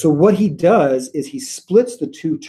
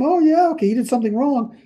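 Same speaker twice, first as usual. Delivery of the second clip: high sarcastic "oh yeah, okay"; sarcastic jab at God's response, much higher; mock sarcastic aside; 12 semitones higher than usual. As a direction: brush it off with irony. Mock dismissive aside to God.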